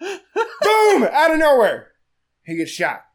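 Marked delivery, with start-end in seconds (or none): 0.0-1.9 excited. Real, not acted. Telling the twist with a laugh.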